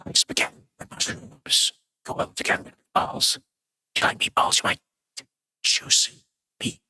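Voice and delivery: unvoiced whispered rambling. Rambling to viewers about spaghetti, in a mock ASMR whisper. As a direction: murmur it close to the mic, half joking.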